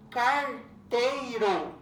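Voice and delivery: "Carteiro" drawn out slowly, syllable by syllable; stretched syllables. Shown as a demonstration. Teacher sounding out a word for pupils, "Carteiro" in stretched syllables. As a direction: stretch each syllable of "Carteiro" with little break. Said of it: "Separe a sílaba das palavras".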